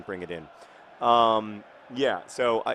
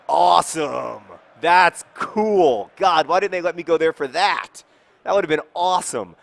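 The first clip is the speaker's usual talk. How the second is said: louder and higher, gleeful; real delight; 6 semitones higher, 10 dB louder than usual. Real, not acted. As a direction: cheer at the news. Learns Mickey's houses were demolished.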